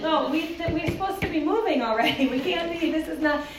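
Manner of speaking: a hint of a flustered organized teacher; flustered hurry. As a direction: brush off the question in a hurry. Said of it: "who go".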